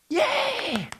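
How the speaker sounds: a high, soft mock cheer; sarcastic yay. Preacher mocking relief over one fixed ailment with a sarcastic yay. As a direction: fake delight at a new health problem.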